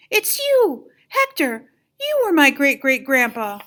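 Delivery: high, loud astonished cry; surprised exclamation. A narrator's reading. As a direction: realise it all at once. Miguel's shocked realisation.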